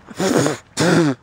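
sudden choking cough. Real, not acted. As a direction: cough out a bug. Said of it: "that bug just flew in my mouth".

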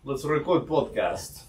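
put-on Russian accent. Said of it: "you do Russian accent".

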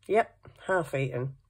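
ordinary delivery

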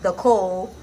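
ordinary talk

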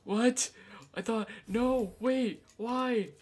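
loud high disbelief; shocked. Real, not acted. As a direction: shout it in disbelief. Shocked at game event.

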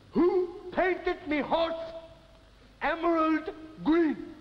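high, slow outraged yell; furious horse owner; indignant demand. Acted. Angry horse owner in pub.

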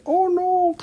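a tiny high-pitched oh no; crystal's squeaky dismay. Pretend. Host voicing a falling crystal, a high squeaky oh no.

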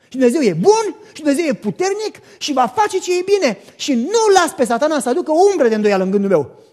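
loud, driven preaching; fiery preaching. Acted. Preacher urging the congregation to believe, fiery preaching. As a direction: preach it with full conviction.

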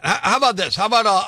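ordinary talk, nothing marked

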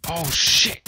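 ordinary talk

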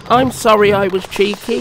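high, small-engine apology; apologetic engine. A narrator's reading. Thomas apologises to Gordon after rescue, high meek voice. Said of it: "said Thomas".

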